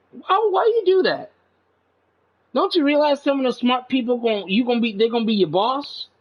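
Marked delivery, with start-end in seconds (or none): 0.1-1.3 pitched way up in disbelief; indignant high cry. Real, not acted. To the bullies of smart kids, high indignant disbelief. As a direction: throw the question up high, baffled.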